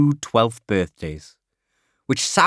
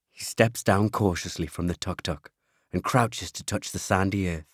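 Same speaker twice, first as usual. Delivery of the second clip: ordinary delivery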